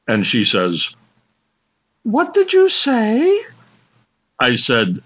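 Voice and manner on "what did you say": high-pitched confused woman. Acted. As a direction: squeak it like a puzzled lady. Woman voiced high in bit.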